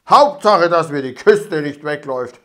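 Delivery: gloating robber, loud. A narrator's reading. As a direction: boom it out, pleased. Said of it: "rief er ihnen nach". (lauter Triumph)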